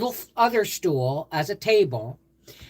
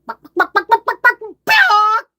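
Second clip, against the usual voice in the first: much higher, loud clucking hen sounds; chicken clucking; 11 dB louder than usual. Retold or imitated. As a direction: cluck like a hen to make a toddler laugh. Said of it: "he'd pull up a chicken".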